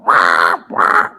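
low mock horn blast; imitated train horn. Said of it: "somebody going".

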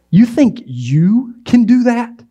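high, slow, sneering disbelief; scoffing doubt. Retold or imitated. Doubter sneering at a bold plan. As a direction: drag it out in scorn. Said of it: "That's what they're asking".

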